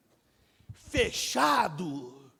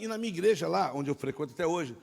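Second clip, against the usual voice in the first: ordinary talk, nothing marked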